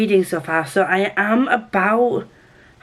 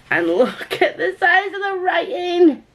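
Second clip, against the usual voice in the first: excited squeal; 11 semitones higher than usual. Real, not acted. Showing off a new book to viewers, excited squeal. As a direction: shriek with joy.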